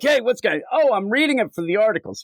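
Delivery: high, quick nervous defense; flustered excuse. Retold or imitated. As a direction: play a caught reader stammering. Reader caught excusing himself.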